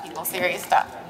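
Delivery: deep, deadpan, pretend-serious; low mock-stern aside to the audience; mock solemn. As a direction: drop the voice low and grave for a fake serious warning.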